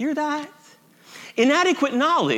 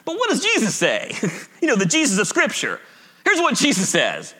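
usual speaking voice

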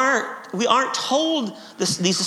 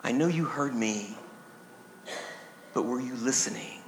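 low, soft, measured parent; parent's quiet reply; 8 semitones lower, 11 dB quieter than usual. Acted. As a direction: answer the child low and softly. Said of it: "to which the parent replied".

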